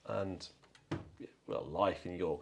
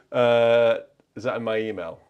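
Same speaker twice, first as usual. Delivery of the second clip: ordinary talk, nothing marked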